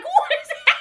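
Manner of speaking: real stunned squeal; disbelief. Real, not acted. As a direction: squeak it out in shock. Reliving surprise gift.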